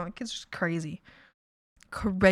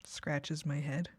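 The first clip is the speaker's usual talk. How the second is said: quiet; 6 semitones lower, 12 dB quieter, slower than usual. Real, not acted. Quiet aside while thinking.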